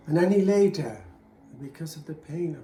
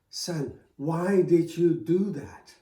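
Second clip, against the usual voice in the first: incredulous father; 3 semitones higher, 7 dB louder, slower than usual. Acted. Father can't believe it.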